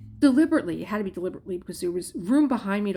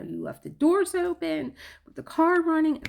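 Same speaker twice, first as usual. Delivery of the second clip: mock yell; 10 semitones higher, 5 dB louder than usual. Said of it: "he's screaming that".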